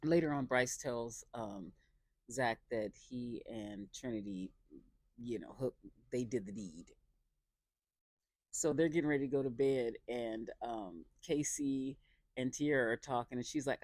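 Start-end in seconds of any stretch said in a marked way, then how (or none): none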